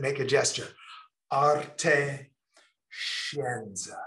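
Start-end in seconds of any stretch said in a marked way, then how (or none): 1.3-4.0 Arte scienza in a hammy Italian accent, open vowels. Shown as a demonstration. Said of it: "Say it in your best Italian accent".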